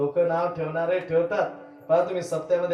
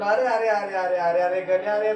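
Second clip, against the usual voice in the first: crying out under blows; 4 semitones higher, 4 dB louder than usual. Acted. Mother cries out while beaten.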